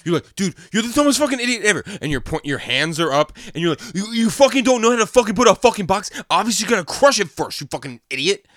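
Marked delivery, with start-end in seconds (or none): 0.4-1.8 higher, louder, fast mock rage; imitating friend's mad yelling, hammed up; mock angry rant. 3.9-8.5 higher, louder, fast mock rage; imitating friend's mad yelling, hammed up; mock angry rant.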